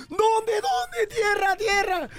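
high, excited acted cry; overjoyed owner. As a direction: call out eagerly for land. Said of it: "se puso bien contento el dueño".

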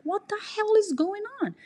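high, stunned demand; upset demand. Retold or imitated. Answering the boyfriend's call, high upset demand. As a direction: confront him in disbelief.

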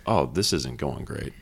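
low, slow, softened aside to self; retold thought, dropped soft and low; deflated inner thought. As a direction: voice a sinking realization, quietly and slowly. Voicing sinking thought during Zoom interview.

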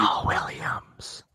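sly unvoiced whisper. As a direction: whisper it as a jab. Whispered jab at opponent.

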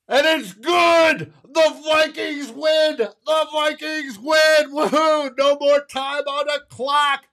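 soaring high, drawn-out shouts of triumph; ecstatic victory call. Real, not acted. Fan broadcaster calling the winning kick to his viewers, ecstatic shouting. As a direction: scream the winning kick home like a homer announcer.

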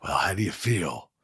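understated tough-guy impression; quietly laconic star director; Clint Eastwood. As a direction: ask it casually in a restrained director's voice. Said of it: "listen to you doing Clint all day long".

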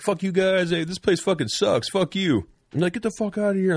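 high, mocking jeer at the bar crowd; mock tough-guy yell. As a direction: drunk buddies jeering on the way out. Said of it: "I'm ad-libbing that".

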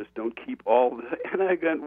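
ordinary talk, nothing marked

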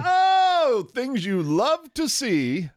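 high, loud, drawn-out whoop of delight; gleeful crowing. Real, not acted. Crowing to co-host over the rival's slump, gleeful. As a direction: crow it high, stretching the words out. Reacting gleefully to the draft pick.

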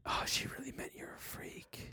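paranoid whisper. Retold or imitated. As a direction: hiss it to yourself. Inner voice whispered.

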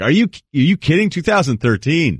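high-pitched pretend disbelief, stretched at the end; mock incredulous. Imagining colleague's answer about best year, mock incredulous. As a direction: leap up high as if the question is absurd.

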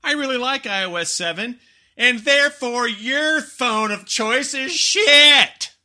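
high, loud, very slow smug boast; smug gloat. Retold or imitated. Fanboy gloating over someone else's phone. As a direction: gloat at the other phone's owner. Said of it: "walk out and go".

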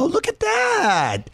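impressed. Real, not acted. Host impressed by the hack.

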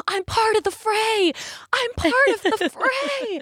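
proud he's with the band, bursting with excitement; enthusiastic. Retold or imitated. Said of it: "so enthusiastically be like".

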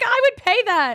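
high, loud burst of willingness; eager retold offer. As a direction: jump on the offer, pitched right up. Retells eager offer at karaoke.

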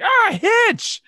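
very high, loud mimicking yell; high-pitched, hammed-up shout; mock excited holler. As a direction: holler it out like a mocking catchphrase. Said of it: "you know, it's like".

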